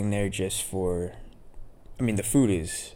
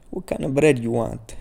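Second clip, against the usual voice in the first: put-on a light, higher-pitched touch of an old Italian deli guy; imitating old Italian deli man; 5 semitones higher, 4 dB louder than usual. Old deli owner asking a regular about bread, lightly imitated. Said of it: "he's a funny old Italian guy".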